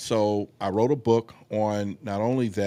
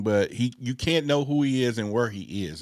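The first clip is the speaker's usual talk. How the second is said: ordinary talk, nothing marked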